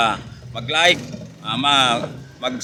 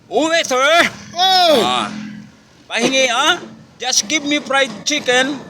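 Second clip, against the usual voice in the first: raised, slow mock American waiter call; American shouting; 9 semitones higher than usual. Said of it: "isang Amerikano doon sa tabi niya sumigaw".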